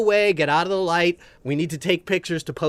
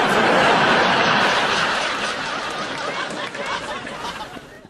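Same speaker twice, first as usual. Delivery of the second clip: high laugh. Real, not acted. Laughs at own story.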